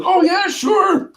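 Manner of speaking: very high, slow squeal of yeah sure; high excited girl voice. Retold or imitated. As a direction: gush it like a bubbly surfer girl. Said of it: "She goes".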